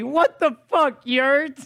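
mock shout. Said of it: "Be me".

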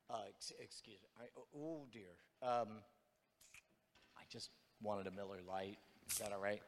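timid tourist entering a saloon. Acted. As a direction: creep in like a scared stranger, barely daring to speak. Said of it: "let me give an impression of me walking into a saloon".

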